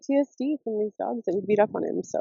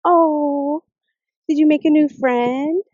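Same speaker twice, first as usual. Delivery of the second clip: pet talk; 5 semitones higher, 6 dB louder, much slower than usual. Real, not acted. Owner cooing at the neighbor's little dog, high baby talk. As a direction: coo at the new dog.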